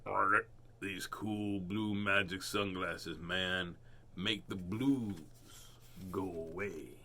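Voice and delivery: low, slow laid-back toad voice; cool dude toad. Acted. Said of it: "He said".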